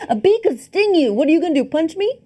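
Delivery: high, loud-ish comic taunt; mock taunting. Friend riffing on fighting a beekeeper, mock taunting. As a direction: goad them in mock outrage.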